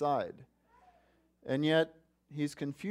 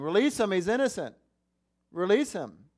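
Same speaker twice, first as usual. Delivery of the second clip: raised higher and louder, pleading; imagined plea shout; 5 semitones higher, 4 dB louder than usual. Retold or imitated. Preacher imagining disciples shouting for Jesus, raised plea. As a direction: call it out like a disciple defending him. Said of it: "there shouting".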